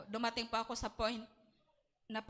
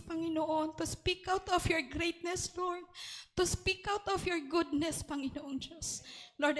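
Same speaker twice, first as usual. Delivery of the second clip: earnest spoken prayer, pleading to the Lord; heartfelt prayer voice; fervent prayer; 2 semitones higher than usual. Acted. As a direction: pray it aloud with devotion. Opening prayer, Tagalog-English.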